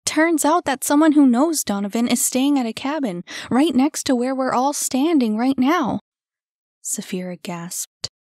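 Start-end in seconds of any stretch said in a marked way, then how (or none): 0.0-6.0 higher, louder eager news; bursting to share the news; loud excited telling. A narrator's reading. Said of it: "her excitement mounting".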